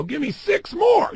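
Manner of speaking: high, loud, slow push from a coach; trainer's shout. Pretend. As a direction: bark it like a trainer at the gym. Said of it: "They go".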